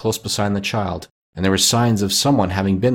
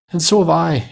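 put-on higher, eager child chiming in; eager exclamation; 8 semitones higher than usual. A narrator's reading. Another child joining in after Peter, high eager exclamation. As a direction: pipe up high in agreement. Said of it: "exclaimed another".

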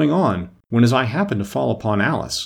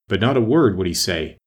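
ordinary delivery

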